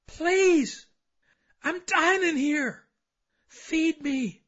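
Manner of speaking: loud, high, drawn-out plea; desperate pleading. Acted. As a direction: beg as a starving spirit trapped inside. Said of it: "it will plead from the deepest recesses".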